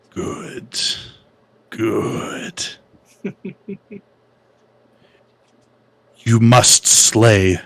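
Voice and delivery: slow menacing Sith whisper; sinister whisper. Acted. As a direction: tempt him like a dark lord. Voice begin to whisper to you.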